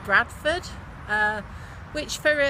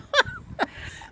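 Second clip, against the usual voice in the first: warm laugh. Real, not acted. Fond laugh recalling childhood.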